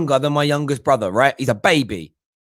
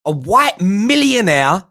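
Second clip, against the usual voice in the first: ordinary talk, nothing marked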